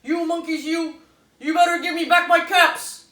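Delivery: loud, high, stronger telling-off; angrier scolding. Acted. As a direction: wag both hands and scold harder. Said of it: "I'm gonna take both hands".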